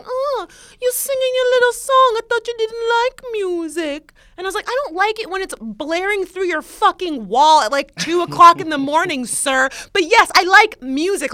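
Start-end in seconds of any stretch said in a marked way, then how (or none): 0.0-4.1 very high teasing imitation; mock smug. Neighbor teases her about singing, smug high imitation. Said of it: "he said it so smugly". 4.7-11.3 loud, raised-pitch retold comeback; indignant retort. Woman retells snapping at noisy neighbor, indignant and loud.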